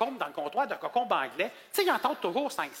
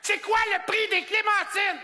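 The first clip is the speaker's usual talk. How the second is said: high, loud, slow shouted demand; angry customer; 11 semitones higher, 4 dB louder, slower than usual. Retold or imitated. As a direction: bark the question like an already furious shopper. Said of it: "elle est déjà en colère". (cliente déjà en colère qui crie lentement sa question)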